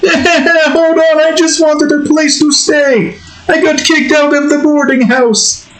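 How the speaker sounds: very high, pleading cartoon voice; Oscar. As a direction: plead with the homeowner in a piping character voice. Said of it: "And we've got Oscar".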